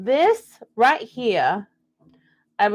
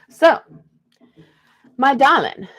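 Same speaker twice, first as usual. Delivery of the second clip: louder Southern Belle endearment; a Southern Belle's sweet darling; 4 semitones higher, 7 dB louder than usual. Pretend. Reader addressing viewer in Southern Belle accent. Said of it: "I'm doing the Southern Belle thing for everybody".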